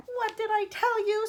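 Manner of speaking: high, loud, slow exasperation; indignant outburst. A narrator's reading. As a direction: exclaim it like an outraged grandma. Grandma declares Wonka is mad.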